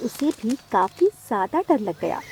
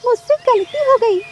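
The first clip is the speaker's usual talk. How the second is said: very high, loud little-bird cry; wailing plea; 12 semitones higher, 7 dB louder than usual. Acted. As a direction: beg mother to stop, high and loud. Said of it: "जोर जोर से रो रही थी". (ऊँची आवाज़ में रोते हुए गिड़गिड़ाना)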